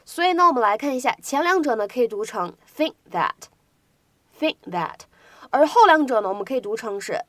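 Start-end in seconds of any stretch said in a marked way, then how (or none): 2.8-5.0 "think that" with the k held, not released; incomplete plosion. Shown as a demonstration. Teacher showing students unreleased k in "think that". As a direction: swallow the k of "think" into "that". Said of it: "有不完全爆破的现象".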